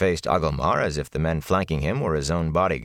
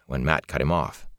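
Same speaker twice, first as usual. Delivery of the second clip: ordinary delivery, nothing marked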